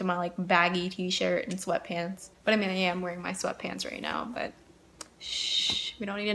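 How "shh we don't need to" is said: hushed secretive shh; whispered shush. Real, not acted. Hushing about the sweatpants.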